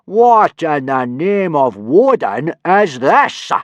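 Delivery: slow, loud, high-pitched outrage; drawn-out incredulous roar; furious bellow. A narrator's reading. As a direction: bellow each word slowly in fury. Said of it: "pointing furiously at the Roman helmet".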